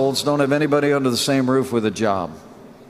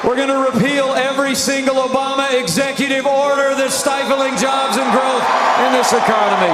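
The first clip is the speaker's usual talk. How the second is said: rally speech; 7 semitones higher than usual. Real, not acted. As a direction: rouse the crowd, voice raised. Rally promises shouted.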